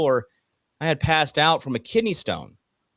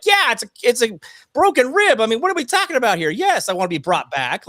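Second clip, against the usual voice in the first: much higher, louder and fast, mock outrage; incredulous outburst; 11 semitones higher, 6 dB louder than usual. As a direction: fire it back like the question is absurd. Retold outrage at doctor's question.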